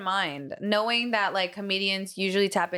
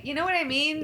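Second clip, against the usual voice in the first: normal talking voice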